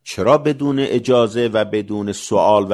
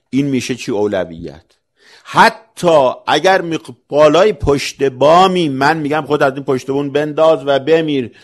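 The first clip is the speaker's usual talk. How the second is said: loud, raised sermon voice, hammering each point; fiery preaching; 4 semitones higher, 8 dB louder than usual. Acted. Preacher driving the point home to the congregation, loud and fiery. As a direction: preach it at full force to the congregation. Preacher rising to full force.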